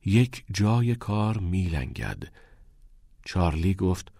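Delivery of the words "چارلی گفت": ordinary delivery, nothing marked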